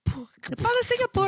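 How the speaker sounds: high imitation of a rapper. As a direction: copy the rapper's hook, pitched high. Mimicking the rapper Singapore.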